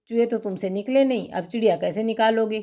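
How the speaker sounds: ordinary talk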